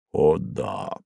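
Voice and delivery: low, muffled and dull; hollow voice. A narrator's reading. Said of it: "произнёс хозяин глухим голосом".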